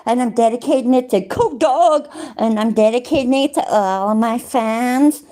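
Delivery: put-on American accent. Shown as a demonstration. Streamer mimicking a video dedication in an American accent. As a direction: do your best American accent. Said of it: "That's my best American accent".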